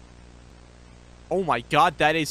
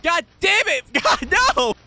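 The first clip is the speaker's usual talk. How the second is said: startled yell; 12 semitones higher, 6 dB louder than usual. Real, not acted. Another jump scare.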